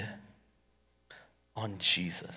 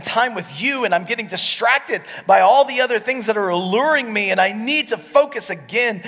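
loud, higher, driving sermon voice; preaching at full force; 10 semitones higher, 10 dB louder than usual. Acted. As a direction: preach it out to the whole room.

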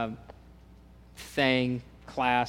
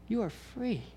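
gentle higher voice of Christ speaking freedom; voicing Christ; 8 semitones higher, 4 dB quieter than usual. Preacher voicing Christ telling listeners they're free, softly. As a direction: lift the pitch, say it softly as Christ.

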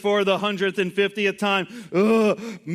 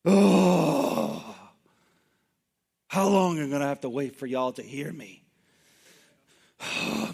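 exasperated groan; 2 semitones lower than usual. Retold or imitated. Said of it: "This was that type of groan".

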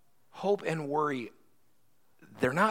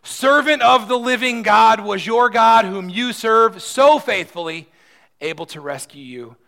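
anguished cry; 10 semitones higher, 15 dB louder than usual. Acted. King crying out in anguish at the lions' den. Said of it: "he cries out in anguish".

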